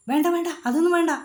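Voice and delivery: quick, flustered no-no-no; hasty refusal. Acted. Grandma hastily refuses bigger range. (വേഗത്തിൽ, പരിഭ്രമത്തോടെ നിരസിക്കുന്നു)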